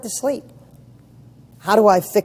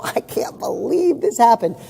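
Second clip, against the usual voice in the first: higher, drawn-out, laughing disbelief; amused disbelief; 6 semitones higher, slower than usual. Acted. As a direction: laugh it off in mock amazement. Laughing off things going wrong.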